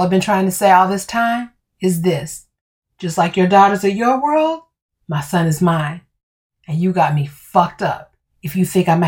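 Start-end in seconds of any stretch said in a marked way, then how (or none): none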